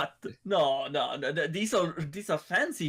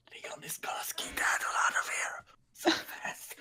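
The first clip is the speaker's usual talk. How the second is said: hushed, voiceless whisper; whispered aside; 6 dB quieter than usual. Real, not acted. Player tells table Leon will flee, whispered aside. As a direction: whisper it under your breath.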